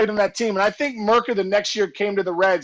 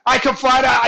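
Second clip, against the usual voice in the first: normal talking voice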